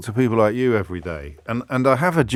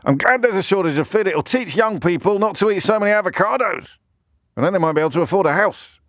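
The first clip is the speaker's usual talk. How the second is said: higher, pompous columnist voice; mock Daily Mail columnist; 10 semitones higher than usual. Pitching a Daily Mail column in a higher, smug parody voice. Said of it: "Will this do, editor?".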